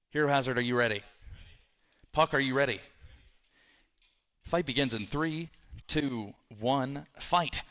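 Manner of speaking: building ring-announcer countdown to the fight; booming fighting game announcer. Acted. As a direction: hype up the start like an arena host. Announces robot fight start.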